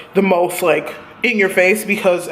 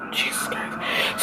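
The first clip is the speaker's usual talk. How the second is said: slow breathy whisper; whispered exasperation; 11 dB quieter, much slower than usual. Real, not acted. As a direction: breathe it out in disbelief.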